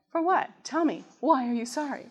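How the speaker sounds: demanding wife. Retold or imitated. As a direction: playfully press him to explain. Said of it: "Wives, you do that, right?".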